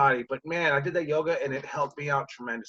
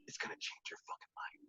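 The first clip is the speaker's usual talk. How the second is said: imitated guru's hushed promise; confiding whisper; 20 dB quieter than usual. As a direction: whisper it like a life-changing secret. Instructor's confiding promise, retold.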